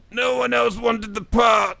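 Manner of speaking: high, loud, slow put-down; slow, high, deadpan old-teacher voice; addled old teacher. Acted. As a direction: drone it out bluntly and loudly. Old teacher deflates pupil.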